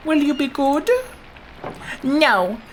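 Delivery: put-on high, bratty toddler voice; mock child voice. Magdalene answering the parent, high and bratty. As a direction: play the cheeky little kid refusing.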